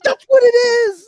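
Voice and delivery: high, loud, drawn-out protest; mock wail of insistence; retold exasperated insistence. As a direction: insist at the top of a put-on high voice. Acted quarrel over butt rock.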